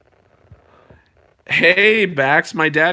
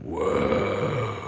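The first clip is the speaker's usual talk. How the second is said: low, soft, drawn-out whoa; hammed-up quiet awe; mock awe; 7 semitones lower, 8 dB quieter than usual. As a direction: let out a hushed low whoa. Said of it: "And then I was like".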